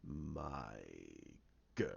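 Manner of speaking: very low, soft villain voice; slow sinister murmur; Snoke. Retold or imitated. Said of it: "you see Snoke's in there going".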